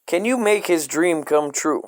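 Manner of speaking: ordinary talk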